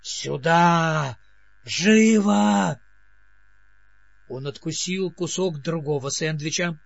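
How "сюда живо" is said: loud urgent command; shouting. A narrator's reading. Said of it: "закричал".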